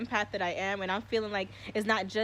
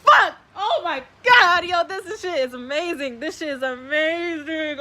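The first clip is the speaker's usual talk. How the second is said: building to a much louder, higher rapturous cry; overwhelmed excitement; 6 semitones higher, 13 dB louder than usual. Real, not acted. Streamer carried away by his star theory, getting louder and higher until he yells. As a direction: let the thrill run away with you, rising to a yell.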